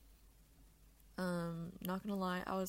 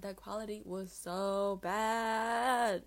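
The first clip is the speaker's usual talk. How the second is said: loud, slow outburst; loud, drawn-out rant; yelling; 7 dB louder, much slower than usual. Real, not acted. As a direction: belt it out in disbelief. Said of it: "Sorry for yelling".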